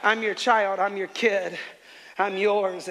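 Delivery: speaking life out loud with conviction; declaring aloud. Retold or imitated. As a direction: proclaim it boldly. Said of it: "I just began to speak life".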